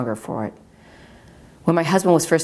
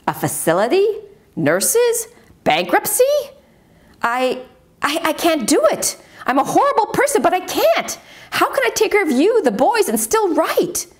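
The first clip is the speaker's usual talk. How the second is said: much higher, distraught outburst; panicked wife; 14 semitones higher than usual. Acted. Wife cries out to Harvey she can't do it, much higher panicked voice. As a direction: let the panic climb out of control.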